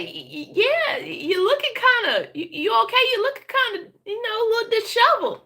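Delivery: put-on high old Southern grandma voice. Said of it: "She said".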